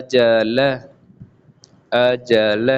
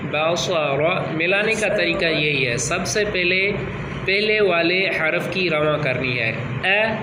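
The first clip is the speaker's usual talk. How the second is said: put-on higher, encouraging voice for a young pupil; patient reading teacher; 8 semitones higher, 4 dB quieter than usual. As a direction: coax the child through each letter. Teacher drilling a child in reading.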